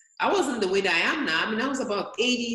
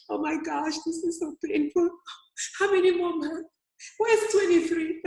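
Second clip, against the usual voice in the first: higher, louder mock-pained moan; retold anguish; 9 semitones higher, 4 dB louder than usual. Runner in pain at mile twenty-three, hammed-up anguished complaint. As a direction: groan it out like aching legs.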